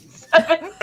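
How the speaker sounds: high, loud delighted cry with a laugh; excited. Real, not acted. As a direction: burst out happy at the roll. Rolls seven after reroll.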